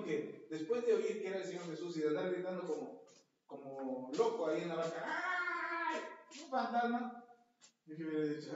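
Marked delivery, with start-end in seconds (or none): 5.1-7.2 high startled yelp, hammed up; mock terrified yelp at a ghost; mock fright. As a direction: play the scared man seeing a ghost. Frightened cry in the boat.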